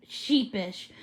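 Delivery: plain reading voice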